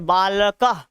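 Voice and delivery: बालकः with a clear final "ha"; teacher's demo of बालकः ending in h; visarga pronunciation. Shown as a demonstration. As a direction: let बालकः end on a puff of h. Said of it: "ह".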